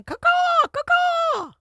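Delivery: very high screeching crow caw; shrill screeching bird woman. Pretend. Mocking hosts as screeching birds.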